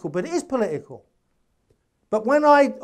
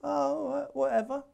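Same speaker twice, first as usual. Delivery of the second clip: quiet, higher, offhand shrug of a reply; mock dismissive; 5 semitones higher, 9 dB quieter than usual. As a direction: brush it off lightly, softer and higher. His offhand reply to the news.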